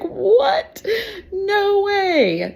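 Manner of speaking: very high, squeaky shocked cry; stunned disbelief. Retold or imitated. Football friend hearing the news, shocked and squeaky. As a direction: gasp it out in total disbelief. Friend learns the teacher's identity.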